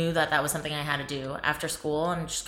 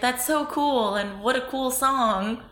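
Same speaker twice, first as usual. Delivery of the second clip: higher-pitched, gushing retold awe; mock-awed quote, pitched up; impressed younger self; 6 semitones higher, slower than usual. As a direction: gush like your easily impressed younger self. Voicing younger self's awed reaction.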